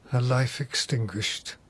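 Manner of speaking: normal talking voice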